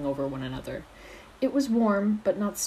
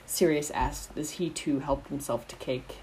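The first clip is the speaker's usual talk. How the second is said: plain reading voice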